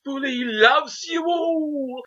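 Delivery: high, drawn-out character call; slow, theatrical high-pitched declaration; Bully impression. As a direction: croon it high and slow, stretching the last word. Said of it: "the way he treats his rats".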